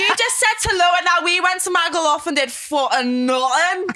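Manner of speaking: high-pitched comic regional impression; big, high, hammed-up character quote; Hannah. Shown as a demonstration. Showing off an impression for the co-host, high hammed-up voice. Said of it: "I can do Hannah from".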